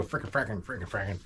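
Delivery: low-key mock grumbling; mild cartoonish swearing under the breath; imitated grumbled cursing. Dad grumbling up the hill, muted mock cursing. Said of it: "I just hear him go".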